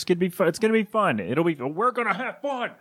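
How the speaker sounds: cheery upbeat dad voice; chirpy family-trip dad cheer; dad vacation mode. Pretend. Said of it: "that's dad vacation mode".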